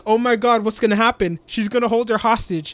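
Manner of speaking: high, loud gasping alarm; shocked reaction. Retold or imitated. Friend recapping the episode, voicing own loud shock. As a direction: blurt it out in alarm. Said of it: "I'm like, I'm like".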